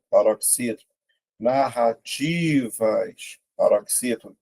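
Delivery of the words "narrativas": "Narrativas" very slow, TI held long; syllable by syllable. Shown as a demonstration. Teacher sounding out an option for the class, syllable by syllable. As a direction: pull "Narrativas" apart, leaning on TI. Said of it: "Vou separar".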